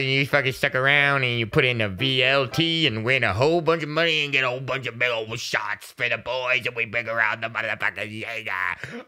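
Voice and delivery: low, run-on mock hype voice; put-on rambling hype. Host hypes the boys who stuck around, low put-on ramble. Put-on rant after the intro.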